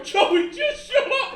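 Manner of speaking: slow, high shouted plea; high slow sisterly yell. Retold or imitated. Sister yelling in another room.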